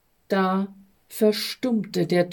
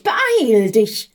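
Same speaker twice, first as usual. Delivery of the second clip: louder but back at normal pitch, pressing; urgent repeat; 4 dB louder than usual. A narrator's reading. Stress and Hectic urge the envoy again, pressing call. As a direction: repeat the urging with more weight. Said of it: "riefen da der Stress und die Hektik".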